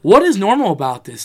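retold argumentative outburst; indignant retort. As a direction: push back, high and incredulous. Retells arguing with father over crying.

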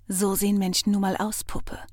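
ordinary delivery, nothing marked

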